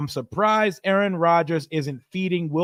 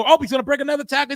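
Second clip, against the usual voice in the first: sudden much higher, fast burst of excitement; excited call; 9 semitones higher, 3 dB louder, much faster than usual. Acted. Announcer calling a broken tackle, excited fast burst. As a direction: jump up as the tackle breaks. Big run during play call.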